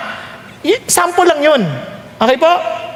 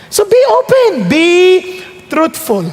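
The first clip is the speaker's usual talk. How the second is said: emphatic appeal; 5 semitones higher, slower than usual. Real, not acted. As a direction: call it out to the hall. Emphatic exhortation.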